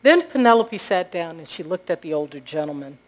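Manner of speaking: ordinary talk, nothing marked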